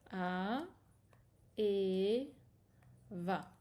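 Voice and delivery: slow syllable-by-syllable A et va; blending A et va. Shown as a demonstration. Teacher blending the letters of a word aloud. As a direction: sound out A, then et, then va, spaced. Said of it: "on reste avec A et va".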